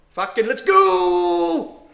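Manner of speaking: slow, high, loud yell; triumphant shout. Real, not acted. Trader cheering the price on, triumphant shout. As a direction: roar it out.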